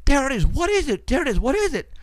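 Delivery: high, mock-frantic ladies yelling; screaming ladies. Ladies in the background yelling at the sky, high mock-frantic. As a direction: squeal it like panicked onlookers. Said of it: "ladies screaming and yelling".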